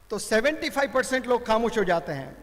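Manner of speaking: ordinary talk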